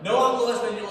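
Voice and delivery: proud declaration. Shown as a demonstration.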